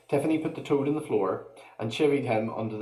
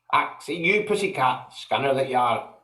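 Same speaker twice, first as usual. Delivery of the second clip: Scottish feagle, higher, taunting; Scottish yell; 3 semitones higher than usual. Acted. Feagle taunting the cat in a Scottish accent. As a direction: jeer in a Scottish burr. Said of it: "he yelled".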